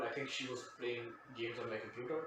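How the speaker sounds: usual speaking voice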